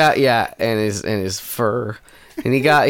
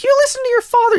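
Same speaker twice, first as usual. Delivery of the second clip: much higher, scolding mock-mother voice; Air Bud's mom; 4 dB louder, much faster than usual. Air Bud's mom scolds her son in a high mock voice. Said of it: "She's like".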